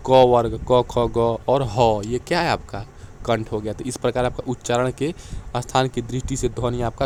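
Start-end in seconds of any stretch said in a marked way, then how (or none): 0.1-2.0 क, ख, ग said louder and very slow; letters called out slowly for the class; sounding out letters. Shown as a demonstration. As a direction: drill the throat sounds one by one. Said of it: "कंठ से बोले जाने वाले".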